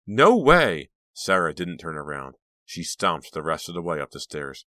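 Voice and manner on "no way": high, loud refusal; angry shout. A narrator's reading. Sarah refusing to return portrait, shouting angrily. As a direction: shout it back at her.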